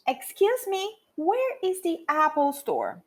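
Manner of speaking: slow, clearly spaced English sentence; careful language-teacher model sentence; model reading. Shown as a demonstration. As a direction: read it out slowly for learners to repeat. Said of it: "eu vou ler a frase".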